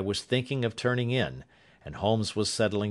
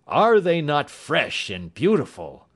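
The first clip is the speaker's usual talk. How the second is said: louder, slow, delighted exclamation; enthusiastic cry; 6 semitones higher, 5 dB louder than usual. A narrator's reading. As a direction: savour the fresh spring air out loud. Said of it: "I cried, with all the enthusiasm".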